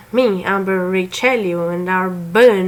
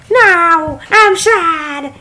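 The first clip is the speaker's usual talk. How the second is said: wife's voice; 9 semitones higher, 5 dB louder, much slower than usual. Acted. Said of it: "she assures me".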